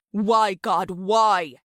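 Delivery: loud, despairing plea; anguished cry to God. Acted. Father crying out to God over his daughter, anguished and loud. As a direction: throw it up at heaven in frustration.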